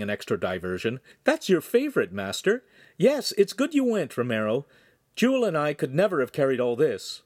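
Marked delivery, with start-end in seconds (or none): 1.2-2.6 put-on young woman voice. Girl speaking to master. 3.0-7.2 put-on young woman voice. Girl answers, high voice.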